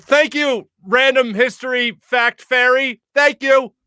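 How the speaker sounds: high, sarcastic thank-you, slowed on the fairy line; mock thanks. Teller mocking a stranger's random fact with sarcastic thanks. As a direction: tease him with over-sweet gratitude. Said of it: "I was like".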